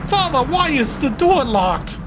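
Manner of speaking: high, pleading question; distressed son. Pretend. A son begs his father at a locked door, high and pleading. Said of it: "Why am I talking like this?".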